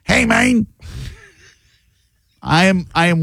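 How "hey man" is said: high mock South American accent. Host showing what people associate, stock South American accent. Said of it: "People associate the South American accent like a".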